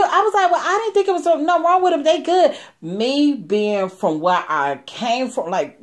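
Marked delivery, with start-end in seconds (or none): 0.5-2.6 high, fast and half laughing; defensive. Retold or imitated. Half laughing excuse to her upset mentor. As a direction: rattle off the excuse, amused.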